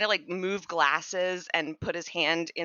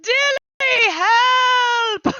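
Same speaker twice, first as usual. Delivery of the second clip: much higher and louder, crying out; squeaky creature wailing for master; wailing for help; 11 dB louder, much slower than usual. Acted. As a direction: call across the circus in terror. Anton cries for his master.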